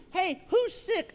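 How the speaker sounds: high, stretched-out cheerful call; eager calling out. Acted. Jesus greeting people he wants to bless, high eager call. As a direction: call out keen to help.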